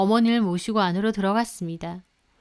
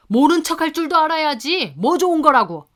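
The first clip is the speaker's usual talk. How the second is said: higher, louder rebuke; father scolding his daughter-in-law, higher and louder; angry scolding; 6 semitones higher, 6 dB louder than usual. Retold or imitated. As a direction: scold her for telling.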